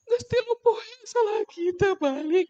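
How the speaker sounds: high-pitched put-on wail; mock weeping lament, high; mock crying. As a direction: play a wife's tearful complaint, hammed up. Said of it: "Ari kita mah nangisnya ada sebab".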